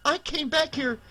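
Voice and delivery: high, desperate scream of betrayal. Acted. Kelly screaming at her brother, high and desperate. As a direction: cry it out high and hurt. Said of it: "she screamed".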